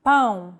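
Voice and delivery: Pão with a nasal ão diphthong, like pown through the nose; nasal vowel. Shown as a demonstration. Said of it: "To indicate nasal vowels".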